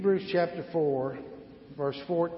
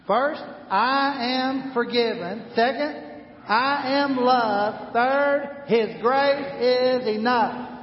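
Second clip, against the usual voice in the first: slow, louder, higher numbered recap; preaching; 9 semitones higher, 6 dB louder, much slower than usual. Acted. Pastor proclaiming the three points to the congregation.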